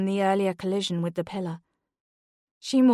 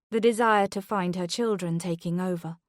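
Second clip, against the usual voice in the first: ordinary delivery, nothing marked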